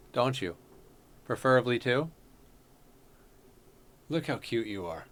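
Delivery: soft cooing to a pet. Real, not acted. Streamer coos to his cat between comments, soft. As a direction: sweet-talk the cat quietly.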